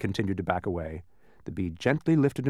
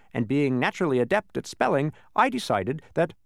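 high, proud bee telling tale; 7 semitones higher than usual. A narrator's reading. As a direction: tell your story proudly. Bee recounts his past.

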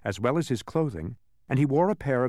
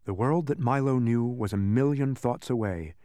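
usual speaking voice

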